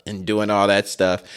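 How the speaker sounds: ordinary talk, nothing marked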